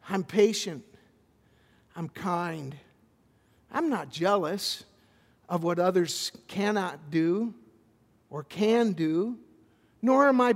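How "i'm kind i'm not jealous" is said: normal talking voice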